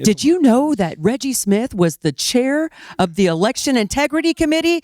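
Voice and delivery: high-pitched indignant rant; incensed. Retold or imitated. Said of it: "she's just incensed about all of it".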